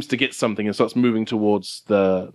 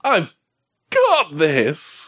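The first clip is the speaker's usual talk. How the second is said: attempted excitement; 6 semitones higher, much slower than usual. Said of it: "with excitement, I want you to tell me".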